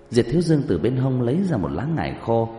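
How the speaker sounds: plain reading voice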